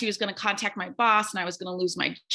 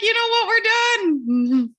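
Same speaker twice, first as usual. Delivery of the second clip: much higher, louder and slow; fed-up outburst; 12 semitones higher, 7 dB louder, slower than usual. Retold or imitated. Imagining telling the angry parent off on a Wednesday, a fed-up loud declaration. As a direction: throw up your hands and declare it done.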